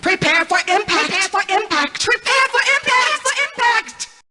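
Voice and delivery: robotic voice pitching up and warping as it fails; a computer voice breaking apart under impact; distorted rising machine voice; glitching robot voice. Acted. AI voice glitching during crash.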